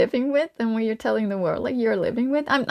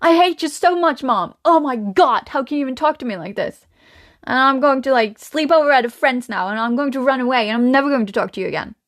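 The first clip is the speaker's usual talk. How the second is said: mock teen outburst, speeding up into a rush; tantruming teenager; 3 semitones higher than usual. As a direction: storm off like a furious teen, hammed-up. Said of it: "go into teenage mode and be, like, slamming doors".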